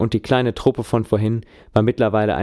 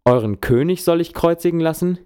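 1 incredulous call; 8 semitones higher than usual. A narrator's reading. Last test of the crowd.

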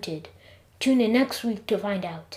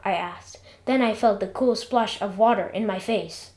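ordinary delivery, nothing marked

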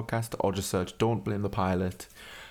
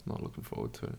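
whispered dread; 10 semitones lower, 10 dB quieter, faster than usual. Real, not acted. Dread mumble.

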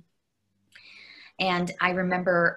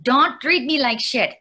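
mother's firm voice; 7 semitones higher, 7 dB louder than usual. Retold or imitated. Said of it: "That's what she would say".

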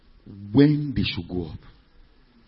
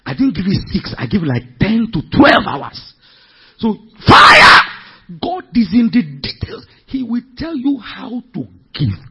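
louder and higher, shouting at the peaks; fiery preaching; 8 semitones higher, 9 dB louder than usual. Real, not acted. Preacher crying out to the congregation, full force. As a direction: preach with fire, hammering each line.

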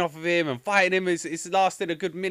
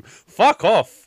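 loud, higher exasperated outburst; exasperated dismissal; 5 semitones higher, 9 dB louder than usual. Real, not acted. Ranting to co-hosts about the film's nonsense, exasperated dismissal. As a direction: throw it out in disbelief.

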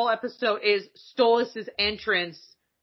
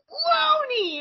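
high, drawn-out parental cry of a name; doting parent. Retold or imitated. Character greeting his daughter in battle, doting parent's high call. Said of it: "that's any parent".

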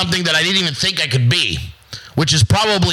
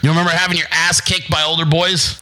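normal talking voice

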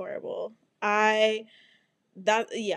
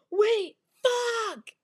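high-pitched mock panic; sudden squealed alarm, hammed up; panicked cry; 12 semitones higher than usual. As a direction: cry out as the door shuts on you. Car door closing on keys.

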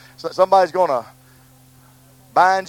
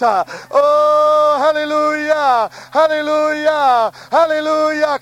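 sustained hallelujahs in prayer; fervent repeated praise; worshipful; 9 semitones higher, slower than usual. Real, not acted. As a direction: let the hallelujahs ring out, drawn long.

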